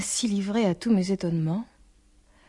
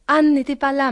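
loud, higher-pitched call; 5 semitones higher, 7 dB louder than usual. A narrator's reading. Father calling to his daughter on arriving, calling out. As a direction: call it out as from a distance. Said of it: "me cria-t-il".

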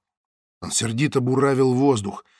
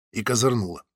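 plain reading voice

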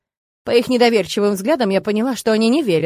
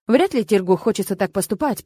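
ordinary delivery, nothing marked

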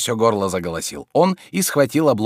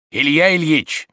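louder, higher call to a sleeper; raised call; 7 semitones higher, 5 dB louder than usual. A narrator's reading. Servant trying again to wake his master, raised call. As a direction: call the name out firmly.

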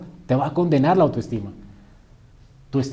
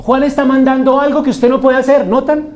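voz muy fuerte y aguda, sostenida; prédica a plena voz; 11 semitones higher, 10 dB louder than usual. Acted. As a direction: recalca el punto a toda la congregación. (clamor enfático de predicador)

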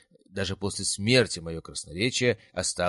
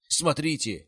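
shouting; 8 semitones higher, slower than usual. A narrator's reading. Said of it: "выкрикнул Вацлав".

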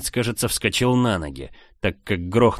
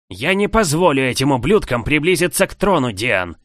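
higher, louder furious hiss; hissed threat; 6 semitones higher, 5 dB louder than usual. A narrator's reading. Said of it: "прошипел незнакомец". (шипит с угрозой, выше и громче)

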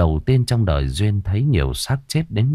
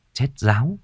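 ordinary delivery

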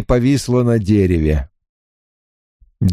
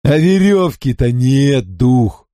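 giggling. Acted. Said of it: "захихикал Винкулюс".

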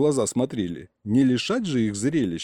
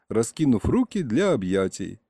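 ordinary delivery, nothing marked

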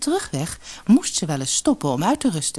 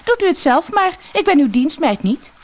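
much higher, louder rude snap; snapping; 12 semitones higher, 4 dB louder than usual. Acted. Rude sister to the lady, loud high snap. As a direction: bite her head off.

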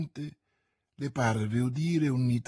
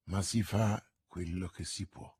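much lower, soft cold contentment; infernal smile; 8 semitones lower, 8 dB quieter than usual. A narrator's reading. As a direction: shrug off murder with a smile. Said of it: "con un sorriso infernale".

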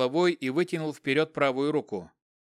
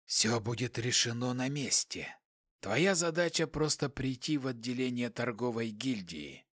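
quiet, emotionless hissing voice; 3 semitones lower, 8 dB quieter than usual. A narrator's reading. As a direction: hiss it softly, without feeling. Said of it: "Шипящий голос не выражал никаких эмоций".

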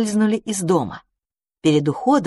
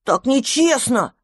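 offended; 6 semitones higher, slower than usual. Acted. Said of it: "обиделся мышевраг".

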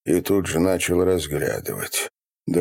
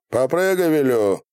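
insistent order; 8 semitones higher than usual. Acted. Repeated order to a captive.

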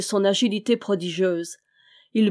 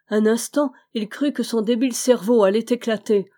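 plain reading voice

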